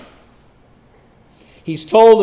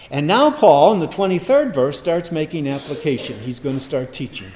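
ordinary talk

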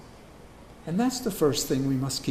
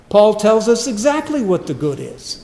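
loud preaching; 6 semitones higher, 7 dB louder than usual. Acted. Preacher restates the line.

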